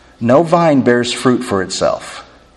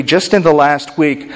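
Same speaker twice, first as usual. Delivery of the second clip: normal talking voice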